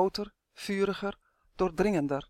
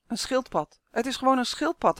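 put-on higher-pitched child's exclamation; girl calling out; 6 semitones higher than usual. A narrator's reading. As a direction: call out the obvious answer. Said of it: "riep een meisje". (roepend meisje)

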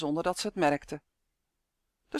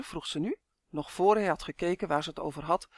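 usual speaking voice

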